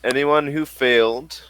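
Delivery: ordinary talk, nothing marked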